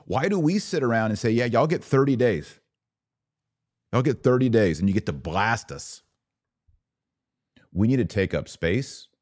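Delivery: ordinary talk, nothing marked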